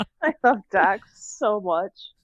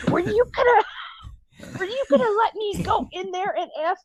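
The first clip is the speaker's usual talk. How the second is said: higher, mock-indignant friend's question; imitating a friend; 10 semitones higher than usual. Friend realizing the cheese joke, higher mock-indignant voice. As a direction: play the friend caught out by the prank. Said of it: "And she goes".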